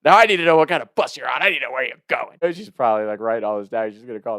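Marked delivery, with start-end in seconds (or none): 0.0-2.4 high, loud, fast demand; hammed-up urgent phone interrogation; freaking-out grandma. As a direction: imitate grandma in a rushed panic. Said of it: "freaking out on the phone".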